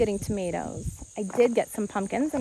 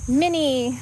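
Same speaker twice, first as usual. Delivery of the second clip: usual speaking voice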